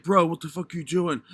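lightly put-on straight dude voice; hint of an acted bro voice; straight-guy voice. Waking up mid role play, lightly put-on straight-guy voice. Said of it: "In your straight voice?".

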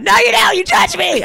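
high, loud mock accusation; tearful outburst, imitated. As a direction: shout it back like the upset friend. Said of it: "she was crying".

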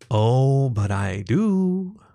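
slow, drawn-out tease; teasing. A narrator's reading. Jackal leans to the fox's ear, teasing slowly. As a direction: stretch it out knowingly.

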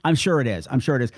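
normal talking voice

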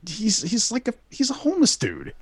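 can't believe he's being asked this; incredulous. Retold or imitated. Telling the cop the guy is a homeless meth head, incredulous.

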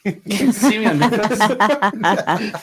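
high, slow, laughing through the line; laughing mock celebrity. Repeating the Netflix line to friends, laughing, slow and high. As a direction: ham up the self-important celebrity while laughing.

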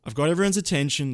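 ordinary delivery

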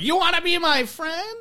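high sweet toy voice; cutesy doll chirp; talking doll. Retold or imitated. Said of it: "the regular doll voice".

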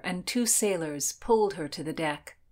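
ordinary delivery, nothing marked

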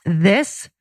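determined, emphatic declaration; firm resolve. Retold or imitated. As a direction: space out each phrase and mean it. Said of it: "this different tone".

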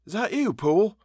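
high, anxious-to-know servant's question; high-pitched questioning voice behind a door; voice from within. A narrator's reading. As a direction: ask in a high voice, rising at the end. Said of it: "a voice asked from within".

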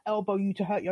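ordinary delivery

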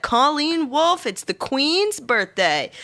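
slow, high party announcer. Pretend. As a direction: announce her like royalty.

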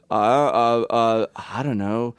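halting, imitated aimless podcast banter; dead air. Mocking unprepared hosts asking each other for topics, stalling. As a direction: leave gaps and fumble for words. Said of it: "have dead air".

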